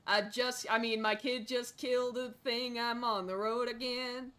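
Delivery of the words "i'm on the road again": usual speaking voice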